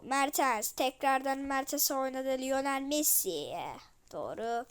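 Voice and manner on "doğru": ordinary talk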